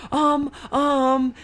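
flustered dad put on the spot; imitated dad stalling. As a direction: grope for an idea out loud. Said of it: "think of something".

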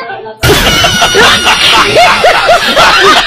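a high shriek of laughter breaks out; cracking up at the skit; high laughing shriek. Real, not acted. Loud laughing fit.

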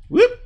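quick high rising whoop; little upward whoops, very high; playful whoop. Real, not acted. Player sounding out moves in a match, playful whoop. Vocal effect for game move.

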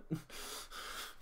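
wheezing, near-silent laugh; breathless silent laugh. Real, not acted. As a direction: laugh without voice. Laughing at the picture.

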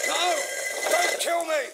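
panicked high shriek, begging for his life; terrified scream. Acted. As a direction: scream in terror at full volume. Attacked man screams for his life.

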